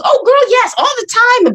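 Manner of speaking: put-on high, loud girlfriend confirmation; imitated emphatic agreement. Best friend confirming memory loss, imitated emphatic agreement. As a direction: mimic a friend agreeing wholeheartedly.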